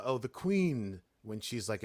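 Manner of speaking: ordinary delivery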